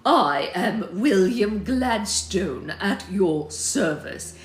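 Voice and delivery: loud, very slow figurehead introduction; William Gladstone. Acted. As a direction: announce yourself grandly, drawing out each word. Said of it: "said the one who'd addressed her first".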